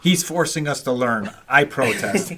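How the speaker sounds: hammed-up complaint about the history lesson; playful fake indignation; mock protest. Jokes the host forces learning.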